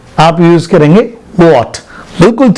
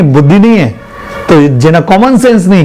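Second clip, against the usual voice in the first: ordinary delivery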